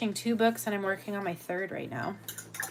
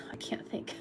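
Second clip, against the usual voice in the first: whispering; 7 dB quieter than usual. Real, not acted. Losing her words mid-story.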